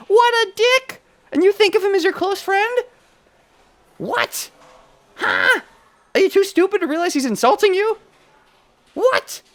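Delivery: very high, loud indignant scream; outraged shriek. Real, not acted. Streamer shrieking at the classmate insulting the protagonist. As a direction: scream your outrage at him.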